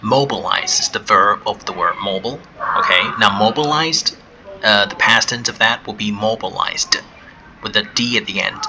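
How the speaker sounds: ordinary delivery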